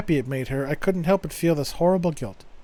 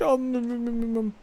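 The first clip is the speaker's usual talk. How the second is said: happy humming; 6 semitones higher, 4 dB quieter than usual. Acted. Girlfriend hugging her boyfriend.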